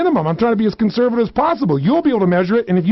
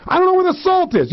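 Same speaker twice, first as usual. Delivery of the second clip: acted mounting frustration; intense grumbling; 3 semitones higher, faster than usual. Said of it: "You say it with intensity".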